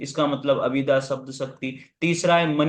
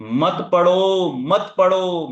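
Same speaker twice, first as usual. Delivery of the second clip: loud, drawn-out plea; emphatic urging; 3 semitones higher, 5 dB louder, slower than usual. Real, not acted. Teacher warning students off other classes, emphatic urging. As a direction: urge it forcefully, stretching the words.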